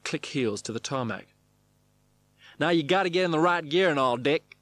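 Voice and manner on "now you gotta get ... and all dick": high, loud cowboy coach; stunt wrangler. A narrator's reading. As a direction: holler instructions, stretching the words.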